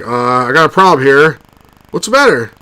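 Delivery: rising to high, loud panic; Porkins scene. Retold or imitated. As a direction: act out the pilots' doomed exchange. Acting Porkins death scene.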